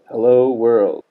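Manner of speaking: like speaking through a pillow; muffled bass only. Shown as a demonstration. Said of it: "sounds very muffled".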